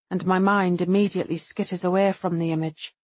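usual speaking voice

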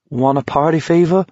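high-pitched, slightly loony offer; mildly unhinged question, rising at the end; crazy. A narrator's reading. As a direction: ask it with a crazed lilt. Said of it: "Baker asked crazily".

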